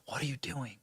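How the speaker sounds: very soft muttering to oneself; imitated whisper. Host imitating a president talking to himself, whispered. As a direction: drop to a hushed self-directed whisper. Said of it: "he, like, whispers to himself".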